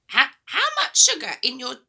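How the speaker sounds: put-on the boy's line read high and loud like Grandma; wrong voice, Grandma's. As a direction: slip into the granny voice by mistake. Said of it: "oh, sorry, that's George".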